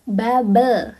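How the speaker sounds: babə, Korean style; Korean pronunciation. Shown as a demonstration. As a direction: say "Bubble" as ba-beu, no L. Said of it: "bukan bubble, tapi bubble".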